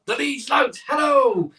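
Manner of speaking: loud, high welcoming call; calling out greeting. Real, not acted. Welcoming new viewer the Leeds Lout, loud call. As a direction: hail the newcomer across the room.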